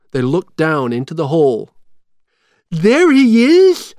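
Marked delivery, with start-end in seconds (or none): 2.7-3.9 high, loud, drawn-out shout; long, high-pitched excited yell. A narrator's reading. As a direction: shout it out on spotting him. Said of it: "shouted Shock".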